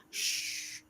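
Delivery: soft drawn-out "shh"; imitated shush from critics. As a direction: hush someone with a long shh. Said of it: "People used to say to me".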